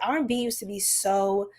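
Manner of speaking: ordinary talk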